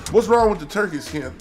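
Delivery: ordinary talk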